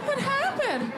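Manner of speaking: squeaky high tearful lady voice, played for laughs; crying tattoo lady. Comedian voicing a crying tattoo lady, high and squeaky. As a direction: whimper it like a sagging old tattoo lady.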